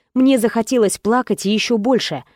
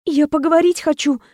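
heroine pleading; 6 semitones higher than usual. Acted.

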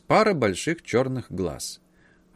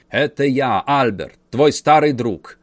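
loud announcement; 3 semitones higher, 6 dB louder than usual. A narrator's reading. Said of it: "объявил дядя".

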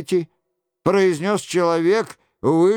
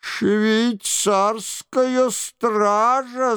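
high, long-drawn alarm call; crying out; 6 semitones higher, much slower than usual. A narrator's reading. Lookout warns of the Swiss guard, crying out. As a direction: call the warning out high and very slowly. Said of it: "закричал Фулон".

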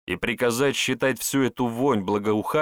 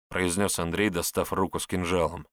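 ordinary delivery

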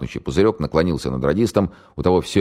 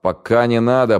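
loud, higher stop order; окрик; 8 semitones higher, 5 dB louder than usual. Acted. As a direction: cut him off with a shout. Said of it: "прикрикнул на него старший майор". (майор одёргивает Дорина окриком)

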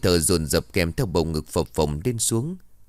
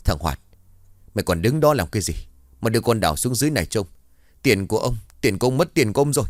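angry yelling; 3 semitones higher, faster than usual. Acted. Master yells at servant.

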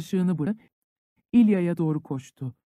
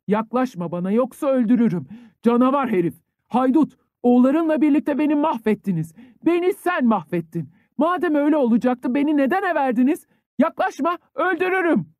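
higher and louder, climbing into a frenzied scream; furious threat; 7 semitones higher, 4 dB louder than usual. A narrator's reading. Ilya threatening his uncle, frenzied and screaming. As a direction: rage escalating until the voice cracks high.

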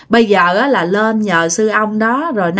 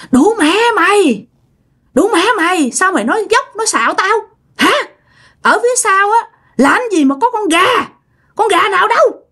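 put-on high, shrill-pitched possessed old woman cursing; 10 semitones higher than usual. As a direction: spit the curses up high like a spirit in her body. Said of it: "Bắt đầu chửi các bạn". (giọng bà bị nhập chửi rủa, cao vút)

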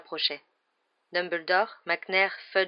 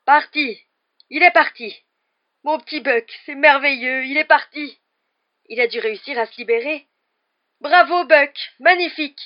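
high, loud overjoyed cries; joy through sobs; 8 semitones higher, 11 dB louder than usual. A narrator's reading. Hagrid rejoicing at his animal's escape, tearful joy. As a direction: cry it out, overcome with relief. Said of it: "ses paroles à travers ses sanglots".

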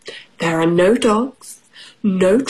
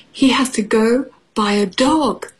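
ordinary delivery, nothing marked

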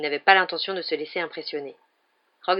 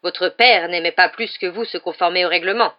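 nasty; 8 dB louder than usual. A narrator's reading. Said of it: "une expression mauvaise".

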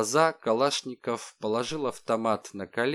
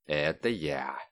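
lower cheerful hero; 7 semitones lower, slower than usual. A narrator's reading. Alexey cheerfully agrees.

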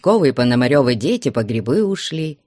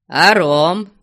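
higher, louder teasing question; egging him on; 4 semitones higher, 6 dB louder than usual. A narrator's reading. Traveller tempting the gloomy watchman with rum, egging him on. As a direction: dangle a tempting offer, voice raised. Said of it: "продолжал подзадоривать мрачного сторожа".

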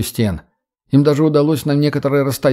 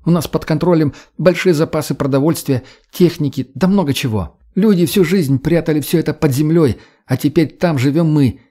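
higher-pitched, eager character voice; earnest young woman; 4 semitones higher than usual. Acted. Redhead pitching coexistence to the boy, eager conviction. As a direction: persuade with conviction, pitch raised.

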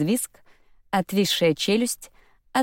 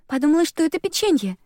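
usual speaking voice

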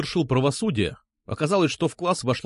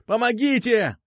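high-pitched pained yell; cry for help; 10 semitones higher than usual. A narrator's reading. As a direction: call out for rescue. Captive cries for help, arm twisted. (высокий крик о помощи)

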